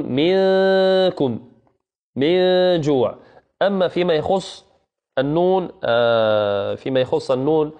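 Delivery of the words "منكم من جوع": slow, raised teacher's demo of منكم with hidden nun; ikhfa demonstration. Shown as a demonstration. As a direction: model منكم slowly and high, nun between ن and ي. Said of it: "تقول".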